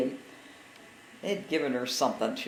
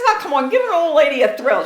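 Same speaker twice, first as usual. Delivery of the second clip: laughing quote; 10 semitones higher, 12 dB louder than usual. Retold or imitated. Mother's plea repeated, laughing.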